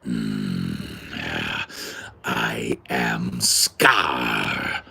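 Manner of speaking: slow, low Scar growl; deep growl rumble. Shown as a demonstration. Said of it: "can do the deep growl rumble".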